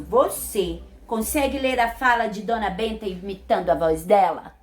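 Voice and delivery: usual speaking voice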